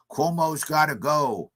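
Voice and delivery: normal talking voice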